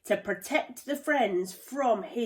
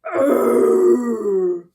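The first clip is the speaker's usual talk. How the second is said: loud snorting roar of a charging buffalo; furious snort; 14 dB louder than usual. A narrator's reading. Buffalo snorting before charging, a loud furious roar. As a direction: snort it out like an angry bull. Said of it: "furious snort".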